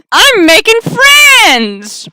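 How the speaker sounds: long, high full-volume shout; triumphant bellow. Acted. Falky determined to make friends, drawn-out triumphant shout. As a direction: holler it slowly, stretching every word. Player bellows defiant resolve.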